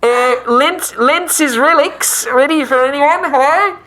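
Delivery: high, loud hawker inside a helmet; shopkeeper calling out. Acted. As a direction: call your wares out in a high, eager put-on voice. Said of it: "as he's calling out".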